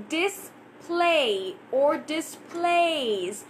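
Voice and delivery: "display or displays" very slowly, A sound held long; lengthened A. Shown as a demonstration. Teacher showing longer A in "display", very slow. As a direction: draw out the A in "display". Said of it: "making it a little bit, uh, longer".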